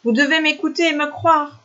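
high-pitched acted pleading; imploring. A narrator's reading. Ailibu urging villagers to believe him, high pleading. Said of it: "implora Ailibu".

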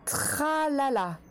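"Tralala" stretched out slowly, then spelled T-R-A-L-A-L-A letter by letter; slow syllable dictation. Shown as a demonstration. Teacher to young pupils, slow careful spelling dictation. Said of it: "La dernière syllabe".

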